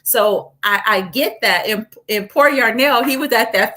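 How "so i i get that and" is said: normal talking voice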